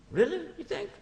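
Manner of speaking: light up with hopeful surprise; surprised hope. Retold or imitated. Brother asking if his sibling is sad, high hopeful surprise.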